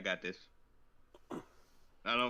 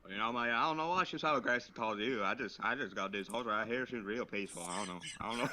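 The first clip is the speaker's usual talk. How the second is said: Texan horse owner, getting lower; put-on Texas accent, trailing lower; deep South accent; 3 semitones lower than usual. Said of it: "You went deep south".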